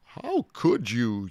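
storybook outrage, lightly yelled; mild yell. A narrator's reading. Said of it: "yelled Iguanodon".

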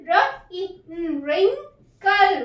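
wrinkle split slowly as wrin-kle; slow sounding out. Shown as a demonstration. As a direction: stretch wrinkle syllable by syllable. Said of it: "ई का short sound". (wrinkle को धीरे धीरे टुकड़ों में बोलिए)

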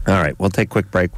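normal talking voice

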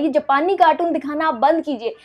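ordinary talk

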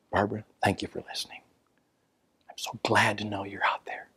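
hushed, intimate radio voice; soft DJ voice. Retold or imitated. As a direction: murmur it close to the mic like a late-night DJ. Radio DJ voice to listener.

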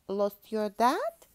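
loud, slow question rising at the end; puffin character. A narrator's reading. Puffin asking the lost cub, loud and slow. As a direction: ask it boldly as a storybook bird. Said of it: "asked Puffin".

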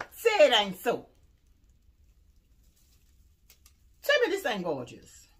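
loud, thrilled exclamation; loud gleeful gushing. Real, not acted. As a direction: shout your delight at the find. Gushing over ornaments.

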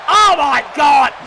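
slow, high, loud gasp of disbelief; shocked shout. Real, not acted. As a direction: react like the arena just exploded. Shocked reaction to kendo stick.

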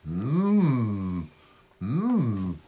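low, soft mmm; savouring hum. Pretend. Host secretly enjoying a sausage sandwich, low savouring hum. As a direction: hum low as if tasting food.

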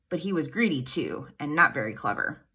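plain reading voice

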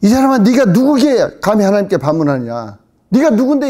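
loud, high-pitched indignant rebuke; preacher's performed dressing-down; acted scolding. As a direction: tell off an upstart, loud and high. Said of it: "자기가 자기한테 야단이야".

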